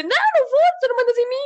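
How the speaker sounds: put-on high, loud bratty kid voice; mock tantrum of a defiant child. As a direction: shout back like a stubborn kid. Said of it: "o puto a dizer".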